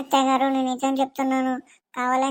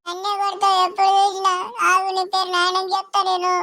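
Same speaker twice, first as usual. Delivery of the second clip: put-on whiny child threat; 7 semitones higher, 3 dB louder than usual. Boy threatens to tell father.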